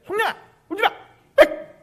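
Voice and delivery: very high yelling at a crier; shrieked scolding. Shown as a demonstration. Preacher showing the wrong way to someone crying, shrill scolding. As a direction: snap at them in a high shriek. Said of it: "이렇게 하는 거하고는 완전히 틀린 거예요".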